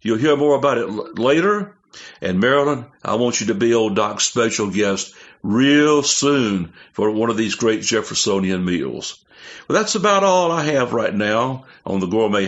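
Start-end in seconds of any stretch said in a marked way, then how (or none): none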